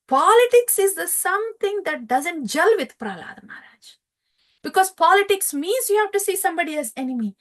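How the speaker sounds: put-on preacher carried away praising the boy saint; fervent narration. A narrator's reading. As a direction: rush through it in devotional ecstasy. Narrator exalts Prahlad's vision.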